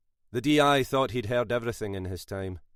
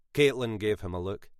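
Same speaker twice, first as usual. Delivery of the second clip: ordinary delivery, nothing marked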